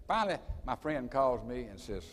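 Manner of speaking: normal talking voice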